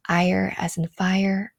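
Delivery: ordinary talk, nothing marked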